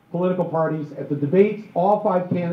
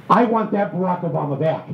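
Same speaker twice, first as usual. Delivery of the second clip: ordinary talk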